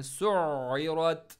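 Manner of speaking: सुइरत slow and loud, ain from mid-throat; stressed ain. Shown as a demonstration. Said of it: "stress के साथ पढ़ना है". (सुइरत में आइन गले के बीच से ज़ोर के साथ)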